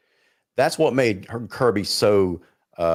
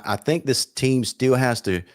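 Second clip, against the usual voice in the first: ordinary talk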